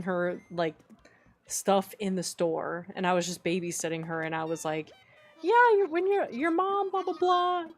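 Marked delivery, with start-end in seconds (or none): 5.4-7.6 put-on talking to a child. Acted quote to child in dream.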